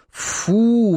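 put-on high, drawn-out kid's "yuck"; disgusted exclamation. A narrator's reading. Boys react together to the monkey menu, disgusted. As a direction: cry out in disgust. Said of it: "воскликнули хором".

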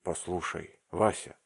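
plain reading voice

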